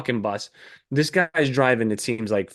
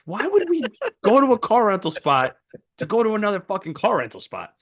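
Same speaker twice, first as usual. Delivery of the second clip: exasperated; 7 semitones higher than usual. Retold or imitated. Traveler griping at the driver, high exasperated. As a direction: let the disbelief climb high. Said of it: "And I'm like".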